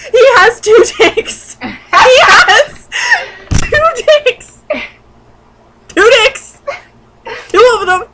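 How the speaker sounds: high, loud, laughing shouts of disbelief. Real, not acted. Reader shocked by the fanfic's two-penis twist, howling with laughter. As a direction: shriek it out through helpless laughter.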